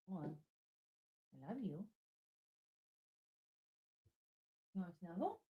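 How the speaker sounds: hushed invitation to snuggle; tender hush. Real, not acted. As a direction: murmur it close to her ear. Murmuring to the dog.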